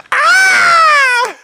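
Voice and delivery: very high, loud wailing cry; crying scream. Retold or imitated. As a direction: shriek like you're watching an attack. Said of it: "I was like".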